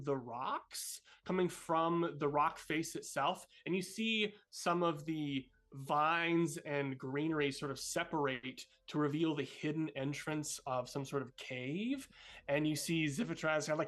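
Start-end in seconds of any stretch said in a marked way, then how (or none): none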